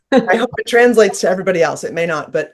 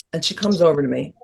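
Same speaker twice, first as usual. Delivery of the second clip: usual speaking voice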